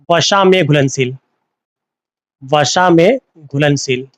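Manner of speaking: slow, spaced-out repetition of a term; teacher slowly dictating a term; slow dictation. Shown as a demonstration. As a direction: draw out each word for learners to follow. Teacher repeats Hindi term for learners.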